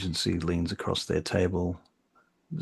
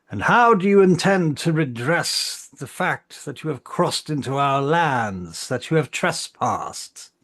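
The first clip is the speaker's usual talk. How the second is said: raised, higher-pitched challenge to the envoys; calling out; 7 semitones higher, 5 dB louder than usual. Acted. Council member demanding redress for trespass, calling out. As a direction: call it out across a court, stretching the later words. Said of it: "calls out".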